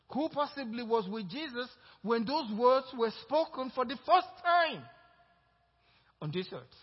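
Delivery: loud, high, rising preacher's cadence; full-force preaching. Acted. Pastor exhorting the congregation, full-force preaching. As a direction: build the sermon to a climax.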